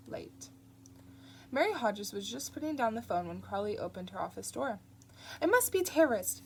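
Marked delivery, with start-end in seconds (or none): 5.4-6.4 higher, louder curt snap; snapped. Acted. Nun snapping at the visitors, higher and curt. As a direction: bite the words off. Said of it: "she snapped".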